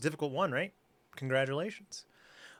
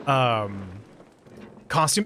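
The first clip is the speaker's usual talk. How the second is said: ordinary talk, nothing marked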